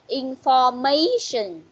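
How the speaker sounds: Information slowly, with "ma" stretched and lifted; stressed syllable demo. Shown as a demonstration. Teacher asking where the stress falls, slow Information stressing "ma". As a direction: model Information so the stress on "ma" is obvious. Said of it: "Rồi, nhấn ở đâu?".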